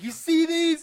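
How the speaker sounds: very high, loud, hammed-up macho voice; douchebag bravado. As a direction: show off your muscles like a total douchebag. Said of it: "He's like".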